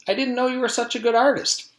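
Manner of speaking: put-on high, admiring child voice; a girl impressed by the plate; high childish praise.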